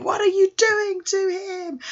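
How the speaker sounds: very high, drawn-out shriek; squealing theatrical protest; mock scream. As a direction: scream it in hammed-up outrage.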